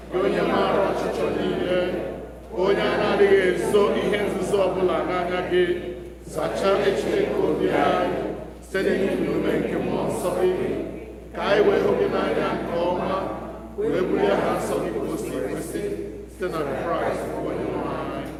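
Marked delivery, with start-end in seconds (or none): none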